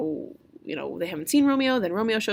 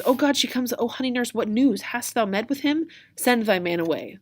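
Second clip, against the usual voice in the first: higher, eager Juliet greeting the nurse; acted excitement, pitched up; 6 semitones higher, 4 dB louder than usual. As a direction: pounce on the messenger for news.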